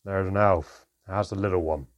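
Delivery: loud call across the clearing; shouting back to Sharon. A narrator's reading. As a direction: call the name out over your shoulder. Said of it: "Handown called over his shoulder".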